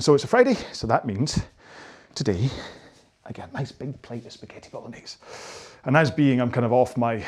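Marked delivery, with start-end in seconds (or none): 2.1-5.2 very soft, secretive hush; hushed relish. Pretend. Coach confiding his Friday dinner treat, hushed relish. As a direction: savour it almost under your breath.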